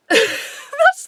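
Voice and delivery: usual speaking voice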